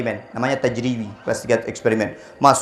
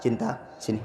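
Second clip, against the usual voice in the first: soft call to wife; 3 semitones lower, 13 dB quieter than usual. Retold or imitated. Calls wife softly.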